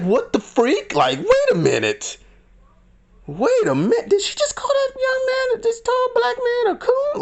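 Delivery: imitating shocked onlookers, climbing very high. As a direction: squeal it in exaggerated disbelief. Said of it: "in shock mode".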